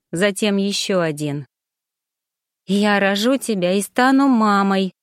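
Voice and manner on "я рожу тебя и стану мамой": ordinary delivery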